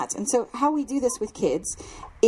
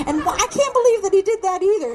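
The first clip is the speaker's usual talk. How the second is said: higher, louder, laughing exasperation; frustrated inner rant; 10 semitones higher, 5 dB louder than usual. Retold or imitated. Complaining to God in your head, laughing frustration. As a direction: vent it half laughing. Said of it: "In your head, you're like".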